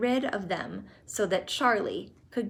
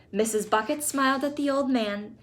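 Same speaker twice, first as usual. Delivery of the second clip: plain reading voice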